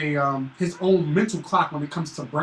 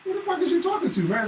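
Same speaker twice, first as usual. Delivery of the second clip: much higher, fast startled double take; 11 semitones higher, 4 dB quieter than usual. Retold or imitated. Dad mimics his own double take at his son, high and fast. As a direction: snap round in disbelief. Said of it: "I got the double take".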